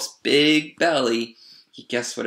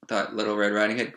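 ordinary delivery

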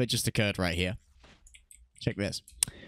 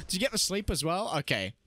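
ordinary talk

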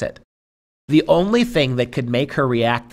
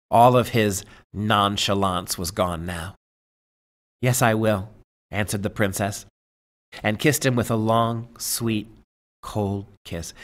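story narration; 2 semitones lower than usual. Acted. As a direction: read the tale aloud, letting each image land. Reads the tale aloud.

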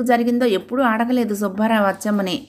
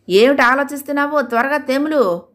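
mild scolding; 6 semitones higher than usual. Acted. Wife scolding her daydreaming husband to hurry, mild scold. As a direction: hurry him up with a sharp nudge. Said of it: "కాస్త గద్దించింది".

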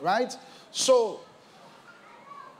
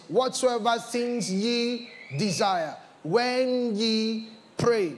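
stretched out word by word; slow it right down to drive it home; slow emphatic repeat; slower than usual. Shown as a demonstration. Preacher repeating the verse to the church, slowly stretched. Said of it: "second reading slowed".

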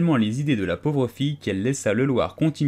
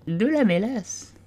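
higher, small, drowsy creature voice, a bit slow; a dozy little animal insisting it was awake; sleepy Dormouse; 11 semitones higher, slower than usual. A narrator's reading. Dormouse defending himself to the tea party, drowsy and high. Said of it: "sans prendre le temps de réfléchir".

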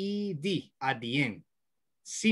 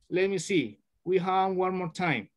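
usual speaking voice

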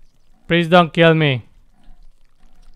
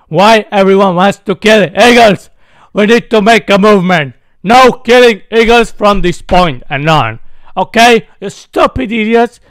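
mock outrage; 4 semitones higher, 6 dB louder than usual. Mock protest against killing eagles.

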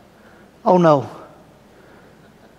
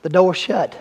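normal talking voice

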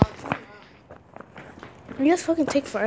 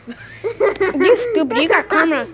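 laughing; 3 semitones higher, slower than usual. Real, not acted. Laughing at friend's spill.

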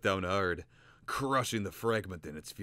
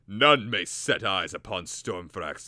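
stern loud warning; 10 dB louder, slower than usual. Acted.

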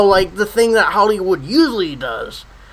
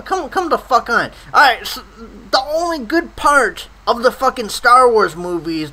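ordinary talk, nothing marked

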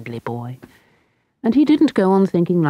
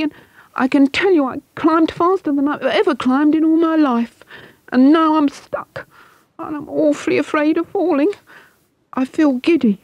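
put-on high, scared boy stuck up a tree; frightened boy; 11 semitones higher than usual. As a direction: play the terrified boy clinging on. Scared boy voiced at length.

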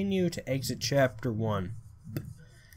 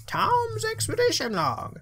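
reading Tom's log in character; 7 semitones higher than usual. Acted. Reads note in put-on voice.

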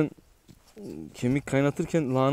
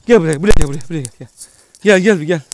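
calling out loud; 3 semitones higher, 13 dB louder, faster than usual. Real, not acted. Calling the dog to come.

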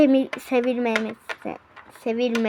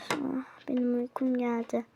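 soft whisper, fading off; sleepy whisper; 4 semitones lower, 14 dB quieter than usual. Acted. A sleepy sister whispering in the dark. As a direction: whisper like she's about to fall asleep.

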